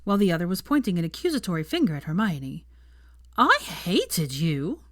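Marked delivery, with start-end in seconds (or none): 3.4-4.8 put-on drunken slur. A narrator's reading. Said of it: "Pansy slurred".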